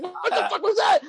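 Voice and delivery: high-pitched mock fright; retold startled cry. Moviegoer retelling his fright at a preview, startled high cry. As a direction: act out jumping in shock, voice shooting up. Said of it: "it scared the shit out of me".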